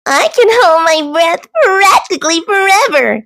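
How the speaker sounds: high, showy boast. Acted. Thistle boasting to Goose and Duck, high. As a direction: brag about it to the others. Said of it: "boasted Thistle".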